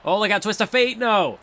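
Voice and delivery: sudden high excited burst as the finisher nearly lands; excited call. Real, not acted. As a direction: yell it out like the move just got stolen.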